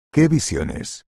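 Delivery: puzzled exclamation. A narrator's reading. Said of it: "exclamó Víctor incomprensiblemente".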